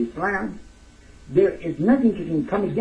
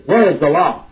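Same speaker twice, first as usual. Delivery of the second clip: pushy demand; 3 semitones higher, 8 dB louder than usual. Retold or imitated. Brother quizzing a newcomer's belief.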